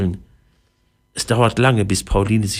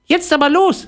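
very high, eager mouse urging; squeaky little voice; 4 dB louder than usual. A narrator's reading. Mouse urging Pauline off to carnival, high squeaky voice. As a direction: squeak it out, raring to go. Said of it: "ein piepsendes Stimmchen".

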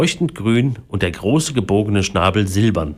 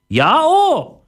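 loud, very high squawk; parrot voice; 5 dB louder than usual. A narrator's reading. Parrot confirms its order, loud high squawk. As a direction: confirm with an emphatic bird cry. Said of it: "sagte der Papagei".

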